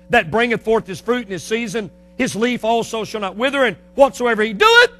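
scripture recited with rising volume; preached scripture. Acted. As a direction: build to a loud, lifted last phrase.

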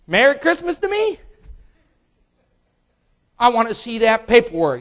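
high, mock-cheerful then demanding; bitter sarcasm, then insist; sarcastic buyer.

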